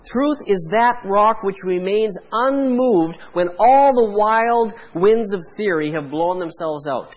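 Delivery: ordinary delivery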